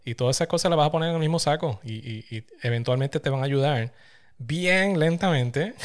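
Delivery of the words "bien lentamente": slow. Acted. Jokey slow word.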